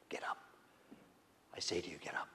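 low whispered command. Acted. Jesus raising the widow's son, low whispered command. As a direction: speak it quietly with authority.

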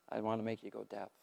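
usual speaking voice

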